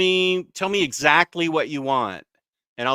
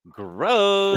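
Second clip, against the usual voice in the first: put-on higher, louder Valley Girl drawl; 5 semitones higher, 4 dB louder than usual. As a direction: play a bored mall girl. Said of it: "saying it like a Valley Girl".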